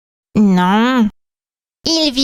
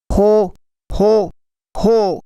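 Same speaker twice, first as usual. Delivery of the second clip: lower, rounded Santa-style ho ho ho; deep Santa laugh; 3 semitones lower, slower than usual. Shown as a demonstration. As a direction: show the deep Father Christmas laugh. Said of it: "avec une voix grave, comme ça".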